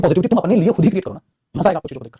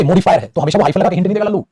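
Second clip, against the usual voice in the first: usual speaking voice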